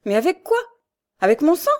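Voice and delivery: high, loud exasperated protest; indignant questions. A narrator's reading. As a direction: throw the questions back at them. Michel stunned and indignant. (protestation indignée, voix haute)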